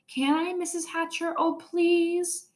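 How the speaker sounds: put-on high eager pleading girl; begging. A narrator's reading. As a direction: beg sweetly and high. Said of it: "begged some more".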